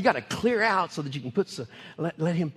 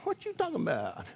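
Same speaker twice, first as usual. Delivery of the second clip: inner voice; 5 semitones higher, 6 dB quieter than usual. Retold or imitated. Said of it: "I'm thinking".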